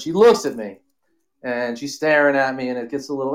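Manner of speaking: ordinary talk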